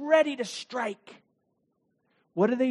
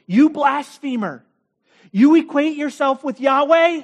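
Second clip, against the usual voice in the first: furious accusers; 6 semitones higher, 7 dB louder than usual. Acted. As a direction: bellow the charge at him. Leaders accuse Jesus of blasphemy.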